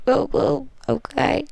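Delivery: high squeaky whoa, okay; mock shocked whoa. Real, not acted. Reader catching her own cheeky reading, mock shocked. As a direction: pretend to be scandalized by your own joke.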